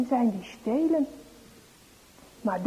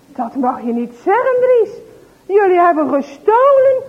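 louder, stern mother; a hint of stern scolding; 6 semitones higher, 10 dB louder than usual. Mother scolding Dries for stealing. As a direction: scold him firmly.

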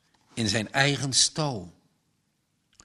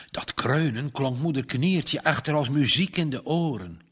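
ordinary delivery, nothing marked

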